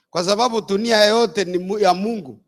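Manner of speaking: usual speaking voice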